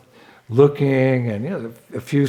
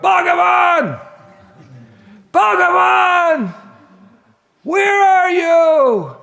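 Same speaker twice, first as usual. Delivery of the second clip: long, high drawn-out shouts; calling out; 5 dB louder, much slower than usual. Retold or imitated. Thief shouting for Bhagavan in the jungle, long high calls. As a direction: call out far into the forest. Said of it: "he just started calling out".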